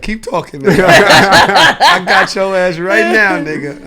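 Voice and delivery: mock gloat with a laugh; gloating through laughter. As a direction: crow it out while cracking up. Imitating Drew gloating in office.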